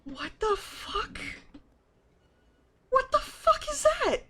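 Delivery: high, rising alarmed disbelief; freaked-out disbelief. Real, not acted. Staring at a body bag in the dumpster, voice climbing in alarm.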